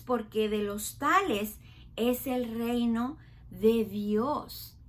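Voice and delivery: slow, weighty Bible reading; teacher reading Jesus' words to children; solemn scripture. Acted. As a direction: read Jesus' words with reverence. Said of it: "fíjense bien lo que Jesús dijo".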